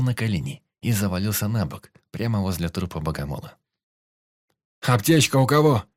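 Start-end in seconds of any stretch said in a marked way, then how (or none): 4.8-5.8 loud, high shout for help; urgent call. A narrator's reading. Андрей кричит отряду, подбегая к раненому. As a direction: call out across the clearing. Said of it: "крикнул Андрей".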